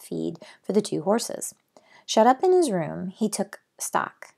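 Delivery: steady read-aloud storytelling voice; story narration. A narrator's reading. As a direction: tell the chapter to the listeners. Reads the book aloud.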